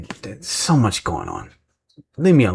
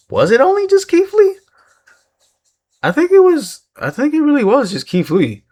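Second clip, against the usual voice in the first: much higher, louder falsetto musing; squeaky self-doubt; 5 dB louder than usual. Acted. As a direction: squeak out your doubt like a cartoon.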